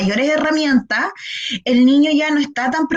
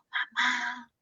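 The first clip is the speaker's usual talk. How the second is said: mamá said very softly, barely voiced; 13 dB quieter than usual. Shown as a demonstration. Therapist modelling calling a sleeping mother. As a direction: call mamá gently so she keeps sleeping. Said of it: "llámala despacito".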